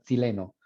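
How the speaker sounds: chileno said as "tsileno"; elite-style "tsileno"; elite Chilean ch. Shown as a demonstration. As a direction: open chileno with a "ts" sound. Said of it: "los de la élite dicen".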